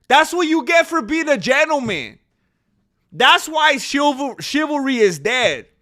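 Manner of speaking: high, loud ranting protest; indignant shouting. Real, not acted. Streamer protesting that chivalry is dead, loud indignation. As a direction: yell it in outraged disbelief, voice pushed up high. Indignant rant defending the man.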